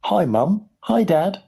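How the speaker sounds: put-on high, little boy voice; very small voice. A narrator's reading. Shrunken boy greeting his parents, very small voice. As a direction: squeak it out like someone tiny. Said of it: "in a very small voice".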